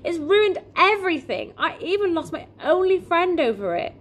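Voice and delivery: put-on high, distressed boy; upset, voice pitched well up; tearful lament. A narrator's reading. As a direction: wail that everything is ruined. Tearful boy continues his lament.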